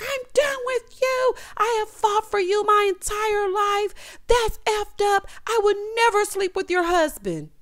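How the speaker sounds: high, drawn-out imitation of wailing; hammed-up crying voice; mock crying. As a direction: imitate a tearful meltdown, very high and slow. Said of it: "she's crying".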